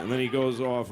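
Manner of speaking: normal talking voice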